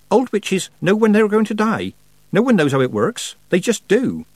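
put-on a nervous young witch sharing lore; earnest high girlish voice; Petulia voiced. Petulia explaining.

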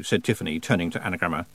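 ordinary delivery, nothing marked

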